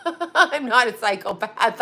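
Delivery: talking through real laughter; giggly, breaking-up delivery; laughing protest. Real, not acted. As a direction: laugh your way through the denial.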